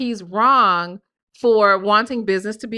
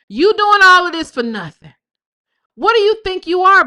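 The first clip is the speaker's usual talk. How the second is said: louder and higher, hammed-up outrage; mock angry retort; 8 semitones higher, 7 dB louder than usual. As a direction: throw her words back at him, loud and indignant. Reading her heated texts aloud.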